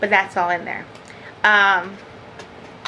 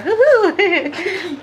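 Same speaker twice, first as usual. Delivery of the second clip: tag; 7 semitones higher than usual. Pretend. Owl hoot with laughing.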